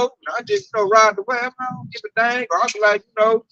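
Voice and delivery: ordinary talk, nothing marked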